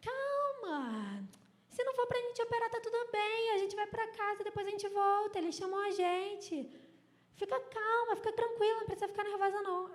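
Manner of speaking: high-pitched, imitated reassurance; mock-soothing, pitched well up. Acting out woman soothing her mother.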